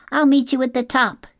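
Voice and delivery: very high, loud gosling call; little gosling. A narrator's reading. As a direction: call out as an eager young bird. Gosling calls to mother.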